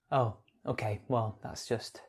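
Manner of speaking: low, quiet, deflated reaction. Pretend. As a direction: let it drop, soft and underwhelmed. Deflated on reading the poem's topic.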